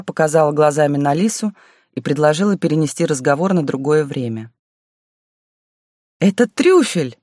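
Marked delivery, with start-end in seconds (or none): 6.2-7.2 put-on high, bursting little girl; excited child. A narrator's reading. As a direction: blurt it out with glee. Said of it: "Лиса внезапно вклинилась в разговор".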